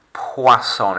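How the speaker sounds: Poisson with oi as wa, not oy; French poisson. Shown as a demonstration. Teacher shows the French wa sound to pupils. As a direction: contrast with the English oi sound. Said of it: "That's oi in English".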